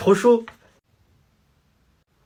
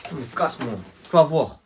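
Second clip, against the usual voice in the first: ordinary delivery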